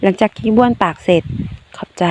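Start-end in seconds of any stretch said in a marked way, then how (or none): 1.5-2.0 breathless whispered thanks; a spent whisper of thanks; weak whisper. Acted. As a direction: barely voice it, drained. Weak after vomiting, thanks him.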